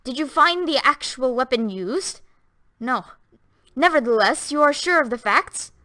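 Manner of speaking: a judge pressing the doctor, clear and deliberate. A narrator's reading. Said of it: "the clear voice of the judge was raised".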